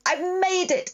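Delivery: high, joyful cry of arrival; excited bark. Acted. Dog barking in joy on reaching the town, excited high cry. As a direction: shout it out in delight, up high. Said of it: "I bark over and over".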